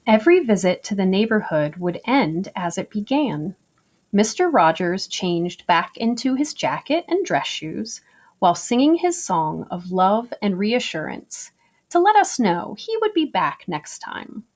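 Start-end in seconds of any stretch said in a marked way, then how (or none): none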